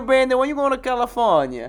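hammed-up Boston North End accent calling out to Brandy; North End tough guy. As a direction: needle him like a North End street guy. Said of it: "North End Tough Guy".

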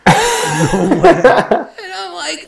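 high laughter breaking through the words; laughing. Real, not acted. Laughing while telling fiancee story.

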